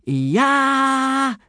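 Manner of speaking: high, drawn-out answering yell; yelled war cry. A narrator's reading. Boys echoing their instructor like maniacs, high yell. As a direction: yell the battle cry back. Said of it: "yelled ten of the boys back at him".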